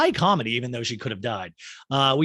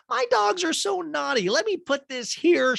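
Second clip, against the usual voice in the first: much higher, squeaky repeat; high fretting Kyle; 4 dB louder than usual. Retold or imitated. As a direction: fret in a squeaky voice.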